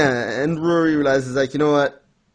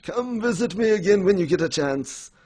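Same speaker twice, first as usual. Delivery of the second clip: higher, put-on cheery farewell; lightly voiced grandpa; 7 semitones higher than usual. Grandpa invites granddaughter back, lightly acted cheeriness. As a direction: lightly imitate a grandpa calling goodbye.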